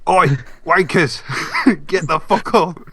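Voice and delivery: mock Teesside wake-up call; British parody accent. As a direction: play a crude British cartoon voice, amused. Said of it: "all of our terrible British accents".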